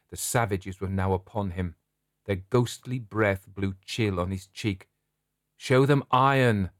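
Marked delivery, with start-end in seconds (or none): 5.5-6.7 higher, louder urgent command; commanding cry. Acted. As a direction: call out to rally him. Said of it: "cried the shadow in an English voice".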